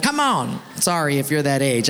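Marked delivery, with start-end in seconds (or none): 0.0-0.6 high-pitched coaxing call; playful acted pleading. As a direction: urge a reluctant friend up. Crowd coaxes Billy.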